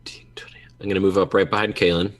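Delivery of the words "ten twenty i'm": quick hushed whisper. Real, not acted. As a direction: whisper it fast under your breath. Whispered aside at table.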